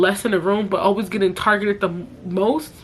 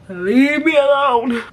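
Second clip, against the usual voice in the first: slow, louder leave me alone; loud plea; 5 semitones higher, 5 dB louder, slower than usual. Real, not acted. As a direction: push back hard, drawn out.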